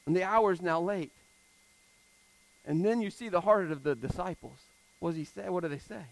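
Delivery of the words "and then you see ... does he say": normal talking voice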